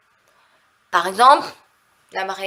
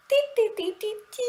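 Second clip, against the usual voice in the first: little high-pitched repeated syllables; high "ti-ti-ti" sounds; 12 semitones higher, 6 dB quieter, faster than usual. Real, not acted. Vlogger showing her hair, high playful filler sounds. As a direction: chirp a string of high "ti"s.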